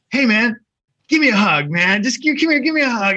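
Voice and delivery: high, eager bro greeting; mock-friendly greeting. To the guys breaking into his car, a high, eager hug request. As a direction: hail him like an old buddy.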